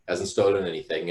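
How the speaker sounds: ordinary talk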